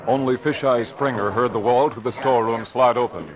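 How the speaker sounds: ordinary talk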